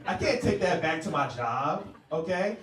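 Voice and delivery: ordinary talk, nothing marked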